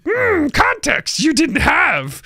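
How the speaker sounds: high, slow wise-creature voice; drawn-out, high mystic musing; Yoda. Retold or imitated. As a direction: pronounce it like a little wise one. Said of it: "Okay, Yoda".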